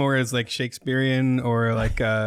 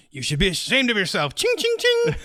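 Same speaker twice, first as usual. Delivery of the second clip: higher hammed-up duel voice with clanging sounds; sword fight taunt; 5 semitones higher than usual. Cohost acting out the hate mail as a pirate sword fight, hammed-up. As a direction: shout taunts mid swordfight, ching ching. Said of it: "Make it sound like they're in the middle of a sword fight".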